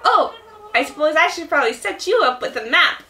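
high-pitched, eager helper voice; little shop helper. Acted. Explaining tent placement, high helper voice. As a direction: chirp the instructions up high. Plays Timmy or Tommy.

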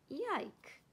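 high, short yelp; yelped yike. Acted. Warthog burnt by fire water, high yelp. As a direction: yelp out in disgust.

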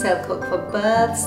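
usual speaking voice

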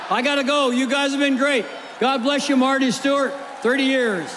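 high, loud farewell to the crowd; shouted sign-off. Real, not acted. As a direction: call out over the applause.

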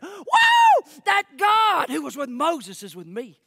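very high, loud "whoa", then loud proclamation; elated shout. Acted. Preacher voicing Joshua's realization about God, elated shout. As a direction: burst out as if suddenly thrilled.

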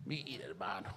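hushed whispered aside; a quiet whisper to the church. Real, not acted. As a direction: lean in and whisper it.

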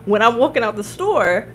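normal talking voice

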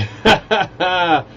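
loud, high cackling laugh. Real, not acted. Streamer joking about escaping the nut house, laughing. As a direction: let out a big bursting laugh.

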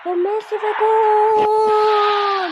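long drawn-out high goal call; goal shout. Acted. Goal call in match commentary.

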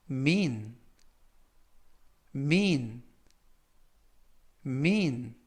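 mean, said slowly three times for students to copy; mean을 천천히 세 번 또박또박; slow repeat-after-me. Shown as a demonstration. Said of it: "천천히 세 번씩 읽어드리고요". (따라 읽도록 천천히 세 번)

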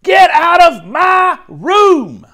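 put-on high, very loud child's shout; furious shout. A narrator's reading. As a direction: yell it slowly, every word stretched. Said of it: "So I shouted".

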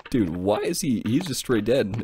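usual speaking voice

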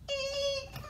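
high-pitched squeak of fright; tiny startled mouse cry. A narrator's reading. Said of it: "Mouse squeaked".

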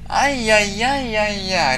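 slow, drawn-out mock dismay; sing-song ai ai ai; mock suspense. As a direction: stretch each ai like a cartoon worry.

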